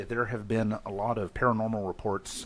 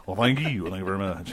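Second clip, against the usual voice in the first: [name] voice; 4 semitones lower than usual. Pretend. Classic [name] catchphrase.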